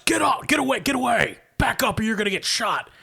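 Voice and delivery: high, urgent shouted warning; guard's shout. Acted. Guard warning a neighbor off the street, shouting high. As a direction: shout a threat as an armed guard. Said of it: "one of the guards raises a weapon and shouts".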